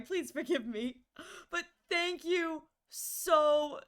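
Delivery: usual speaking voice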